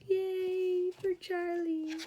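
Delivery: slow, high cheer. A narrator's reading. Storyteller cheering on the little hero, drawn-out delight. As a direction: stretch out a happy cheer for Charlie.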